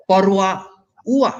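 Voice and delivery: পরোয়া stressed on the ো-য়া glide, a clear w sound; w sound demo. Shown as a demonstration. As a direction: lean on the উয়া in পরোয়া like a w. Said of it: "ডব্লিউ উচ্চারণ হবে". (পরোয়া-র উয়া ডব্লিউ-এর মতো)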